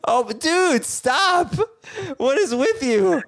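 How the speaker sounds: hammed-up exasperated yelling; mock outraged shout. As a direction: shout at him in fake outrage to stop. Mock outrage at co-host's food snobbery.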